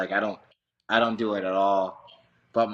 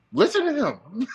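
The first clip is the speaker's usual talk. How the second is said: high, louder mock command; mock pointing; 6 dB louder than usual. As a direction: point and tell him to listen.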